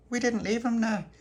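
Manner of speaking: put-on high, childlike protest; protesting little boy. Little pappy defending himself to mum, protesting little boy.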